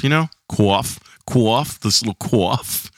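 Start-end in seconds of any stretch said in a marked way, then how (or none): 0.5-1.8 "cough" with a drawn, rounded New York aw; New York-like accent. Real, not acted. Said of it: "why I have an accent". 1.8-2.9 "cough" in a New York accent; drawn-out New York "cough". Real, not acted. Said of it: "why I have an accent".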